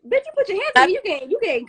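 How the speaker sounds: high, heated mock confrontation; indignant confrontation. Storyteller explaining she handles fights herself, indignant confrontation. Acts confronting whoever touched her.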